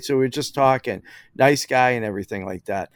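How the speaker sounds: usual speaking voice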